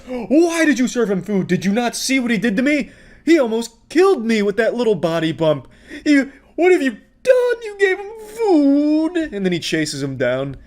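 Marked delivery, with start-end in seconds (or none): 0.1-5.7 high-pitched hammed-up accusation; mock outraged blaming. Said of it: "he starts blaming the pizza place". 6.1-9.2 very high, hammed-up wail; mock anguished blaming. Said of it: "he starts blaming the pizza place".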